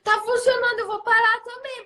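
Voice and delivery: mais aguda e mais forte, convencida; família convencida. Retold or imitated. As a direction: imita a família animada, voz bem mais alta. Família imitada decidindo mudar. (família animada dizendo que funcionou)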